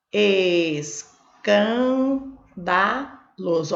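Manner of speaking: Escandaloso slowly split, ES, CAN, DA, LOSO; syllable by syllable. Shown as a demonstration. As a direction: stretch each syllable of Escandaloso with gaps. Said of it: "Eu vou separar". (Escandaloso bem devagar, separando as sílabas)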